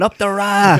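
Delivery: high, loud rallying yell; imitated screaming. Retelling a comedian's stunt in the middle of London, screamed slogan. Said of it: "And he started screaming".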